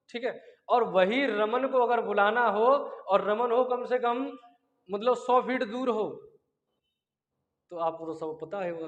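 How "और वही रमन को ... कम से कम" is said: ordinary talk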